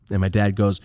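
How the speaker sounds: ordinary talk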